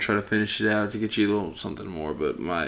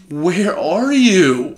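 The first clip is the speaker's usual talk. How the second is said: taunting call; 7 semitones higher, 6 dB louder, much slower than usual. Real, not acted. Taunting hunt for enemy.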